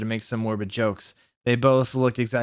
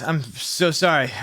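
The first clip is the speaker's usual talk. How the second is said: usual speaking voice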